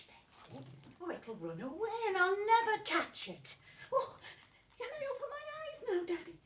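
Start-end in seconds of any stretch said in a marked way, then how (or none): 3.9-6.4 put-on much higher, quick eager child; little girl's voice. Little Betsy asking her daddy, high and eager. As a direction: ask high and fast like a small girl.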